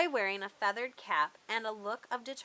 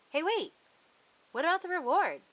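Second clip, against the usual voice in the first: sudden interruption; 7 semitones higher, 5 dB louder, faster than usual. Real, not acted. Interrupting the ending.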